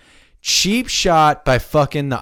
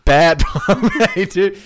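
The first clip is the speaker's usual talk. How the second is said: laughing it out, higher and louder; 8 semitones higher, 4 dB louder than usual. Real, not acted. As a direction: crack up at bad Bombay. Laughing at nickname.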